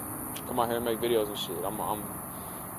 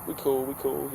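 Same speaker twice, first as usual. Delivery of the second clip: modest brush-off; 7 dB quieter than usual. Retold or imitated. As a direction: wave off the praise quietly. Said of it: "I'm just like".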